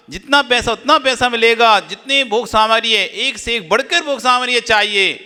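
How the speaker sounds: high, insistent rhetorical list; forceful preaching. Real, not acted. Preaches a rapid list of wants. (ऊँचे स्वर में जोशीला प्रवचन)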